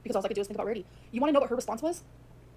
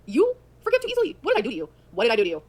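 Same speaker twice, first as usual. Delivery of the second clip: incredulous outrage; 7 dB louder than usual. Acted. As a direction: throw it back in loud disbelief. Acted indignant challenge to the claim.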